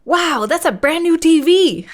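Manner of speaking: high, loud delighted shout; thrilled at a new TV; excited. Real, not acted. Spots a TV in hospital.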